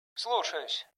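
high, very soft intercom reply; over the speaker. A narrator's reading. Jimmy acknowledging Bilka's order, over intercom. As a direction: acknowledge from a distant loudspeaker.

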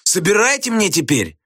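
high, outraged demand; Gradusov wailing. A narrator's reading. Pupil demands teacher pick things up, wailing. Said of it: "завопил Градусов".

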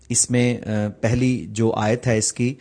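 ordinary talk, nothing marked

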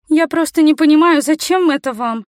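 put-on a girl demanding to understand; baffled girl. A narrator's reading. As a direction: press the question in a high voice. Girl questions the man's motive.

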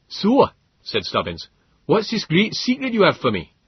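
proud colonist boasting of his mount; Stubbins voice. A narrator's reading.

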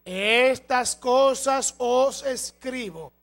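loud, slow, drawn-out proclamation; forceful scripture reading. Acted. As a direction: preach the verse word by word. Reads scripture aloud at full force. (lectura bíblica proclamada con fuerza)

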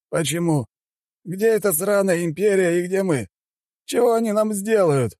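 high-pitched, mocking chairman; amused scorn. A narrator's reading. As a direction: scoff as if about to laugh. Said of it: "расхохотался тот". (насмешливо, высоким голосом)

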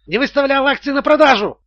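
high, agitated outburst; indignant squeal. A narrator's reading. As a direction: squeal the accusation in outrage. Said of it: "завизжал финансовый директор". (возмущённый визг)

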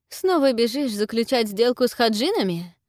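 bare-toothed mocking jab; sneering. A narrator's reading. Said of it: "скалится Ниела".

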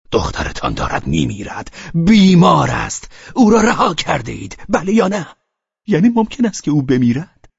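loud, high furious shouts; shouting. A narrator's reading. Velchaninov yells at Pavel about his dying daughter. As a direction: hurl each phrase at him.

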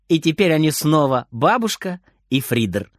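ordinary delivery

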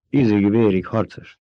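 ordinary delivery

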